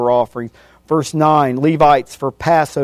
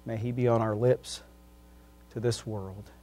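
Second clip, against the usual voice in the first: quiet prayer, fading softer and softer; closing prayer; 3 semitones lower, 5 dB quieter than usual. Acted. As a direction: pray humbly, letting the voice sink.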